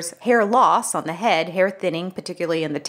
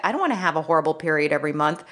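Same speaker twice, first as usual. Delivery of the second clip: ordinary delivery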